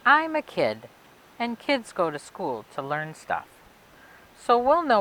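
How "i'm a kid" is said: ordinary delivery, nothing marked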